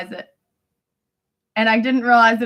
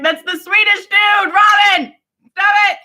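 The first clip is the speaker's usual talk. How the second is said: higher, louder scolding of a friend; exasperated shouting; 7 semitones higher, 4 dB louder than usual. Acted. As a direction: draw it out in mock exasperation. Acted friend scolding Robin.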